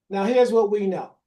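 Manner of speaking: normal talking voice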